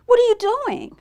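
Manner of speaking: high, loud sing-out question; friendly Sheep. A narrator's reading. Sheep asks Bird what he's doing, high friendly animal voice. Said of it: "said Sheep".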